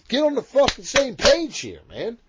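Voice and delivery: high, loud and fed up; exasperated outburst. Real, not acted. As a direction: shout it in frustration at the listeners. Fed up with fans using DMs.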